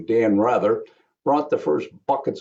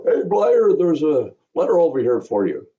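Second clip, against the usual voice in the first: loud, higher call across the locker room; retold holler; 5 semitones higher, 4 dB louder than usual. Equipment man calling the rookie to the mail table, hollering. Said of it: "one of the equipment men hollered".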